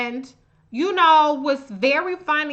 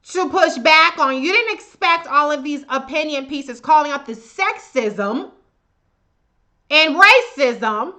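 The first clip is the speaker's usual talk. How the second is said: usual speaking voice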